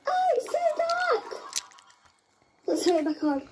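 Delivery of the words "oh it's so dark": surprised squeal. Real, not acted. Lights go out suddenly.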